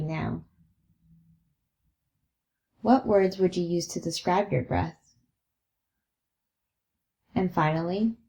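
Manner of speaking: slightly soft, spacious meditation voice; guided meditation. Acted. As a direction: guide their attention to the breath, leaving long pauses. Leads a breathing meditation.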